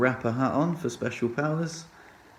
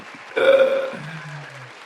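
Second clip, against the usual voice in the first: breathy high laugh; wheezy laugh; 6 semitones higher than usual. Real, not acted. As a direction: burst out laughing. Laughs at own story.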